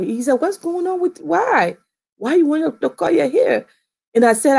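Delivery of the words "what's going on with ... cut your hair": higher, puzzled question as the dad; dad asking his daughter why, baffled. Retold or imitated. Dad questioning her choice.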